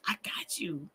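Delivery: soft whispered promise; hushed reassurance. Pretend. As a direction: whisper it like a secret pact. Playful reassurance, first take hushed.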